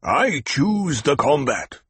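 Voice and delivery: slow growled challenge. A narrator's reading. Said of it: "Jameis growled".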